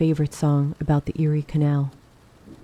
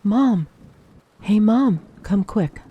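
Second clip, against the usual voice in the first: raised, higher urgent call; hollering; 4 semitones higher, 4 dB louder, slower than usual. A narrator's reading. Girl calls her mother to the bathroom, narrated holler. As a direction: holler out, easing off at the end. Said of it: "I really hollered".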